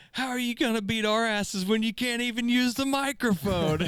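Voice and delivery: high-pitched jeering taunt in imitation; hammed-up, high mocking challenge. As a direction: imitate a wrestler ridiculing his rival on the mic. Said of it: "just starts laughing. He's like".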